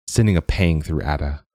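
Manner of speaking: usual speaking voice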